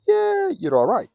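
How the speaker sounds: airy high rising yeah, slowed; breezy mock relief; casual reassurance. Teacher voicing relieved person.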